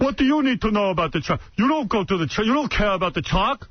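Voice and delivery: suspicious dad fending off; father refusing to talk track; irritated dad. Retold or imitated. Dad snapping at son.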